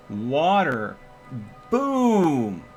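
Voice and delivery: ordinary talk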